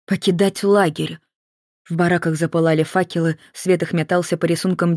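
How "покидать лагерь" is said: a distant voice repeating the guard's order; echoed order. A narrator's reading.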